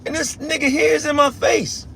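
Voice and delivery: hammed-up annoyed complaint; a hint of exasperation at the interruption; annoyed Lord voiced.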